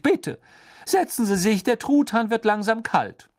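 stern, impatient witch; McGonagall voice. A narrator's reading. McGonagall voiced, higher louder. (strenge Lehrerin, ungeduldig)

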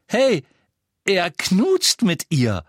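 loud, high, drawn-out "knutscht"; insistent. A narrator's reading. Boy fears he wasn't clear, insistent stress on "knutscht". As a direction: spell out "knutscht" loudly and slowly, as if missed. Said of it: "als ob er Sorge hätte, sich nicht klar ausgedrückt".

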